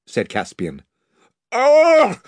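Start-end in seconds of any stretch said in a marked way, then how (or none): 1.5-2.2 loud, high frightened gasp; a sudden cry of fear; startled cry. A narrator's reading. A sailor cries out in terror in the dark, loud and high.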